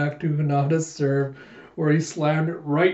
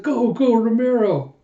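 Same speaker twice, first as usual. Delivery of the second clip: high, raised cheer; excited pitched-up chant of support; cheering fan; 6 semitones higher, 4 dB louder than usual. Acted. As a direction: cheer the player on from the stands. Crowd cheering the player.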